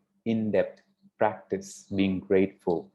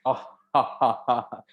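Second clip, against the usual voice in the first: acted, proud belly laugh; delighted king's laugh; 2 semitones higher than usual. Father hearing flattery, uncontrollably pleased laugh. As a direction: let out a pleased "ah, ha-ha-ha". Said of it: "He couldn't control his".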